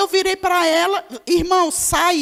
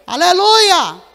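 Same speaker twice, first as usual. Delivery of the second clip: a loud, ringing Aleluia; shouted hallelujah; 2 semitones higher, 9 dB louder, slower than usual. Real, not acted. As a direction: burst out in praise to the church. Pregadora exclama louvor. (Aleluia bem alto, em louvor)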